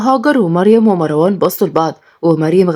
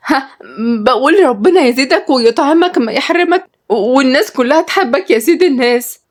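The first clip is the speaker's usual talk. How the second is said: high, nervously fawning voice; startled flattery; 7 semitones higher than usual. A narrator's reading. Sumaya caught cursing by Osama, startled and fawning. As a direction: cover up with eager, high-pitched sweetness. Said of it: "بصت له بخضة".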